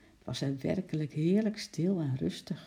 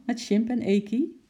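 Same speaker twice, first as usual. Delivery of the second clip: higher, lightly voiced child's question; quick eager request; 6 semitones higher, 4 dB louder than usual. A narrator's reading. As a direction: ask hopefully, pitched up. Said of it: "vroeg Lotje snel".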